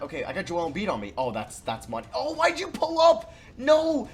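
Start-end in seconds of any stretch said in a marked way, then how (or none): 2.1-4.1 high, loud, drawn-out exasperated cry; frustrated yell. Real, not acted. Gamer yelling at his own player, frustrated loud cry. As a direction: yell at the screen in real frustration.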